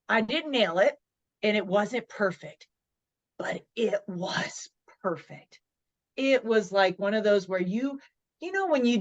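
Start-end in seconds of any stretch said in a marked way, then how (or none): 3.4-5.6 a slow breathy whisper. Real, not acted. Remembering the first talk, slow whisper. Soft reflective aside.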